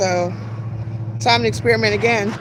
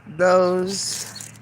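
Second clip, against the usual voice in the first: normal talking voice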